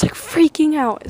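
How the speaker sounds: ordinary talk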